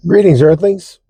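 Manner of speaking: high, loud sci-fi alien greeting. Acted. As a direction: hail the Earthlings like a visiting alien. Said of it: "That's what I like to say".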